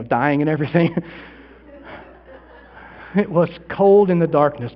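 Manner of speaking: ordinary talk, nothing marked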